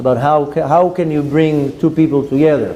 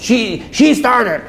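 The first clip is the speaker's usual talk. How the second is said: put-on high, drawn-out child's complaint; slow, high-pitched mock tattling; whining five-year-old; 7 semitones higher, much slower than usual. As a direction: mimic a small child blaming a sibling. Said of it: "sounds like my five-year-old".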